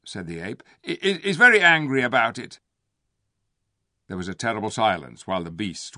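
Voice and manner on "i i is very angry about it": high, loud, fast stammer; stammering ape. A narrator's reading. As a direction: stumble nervously over the words.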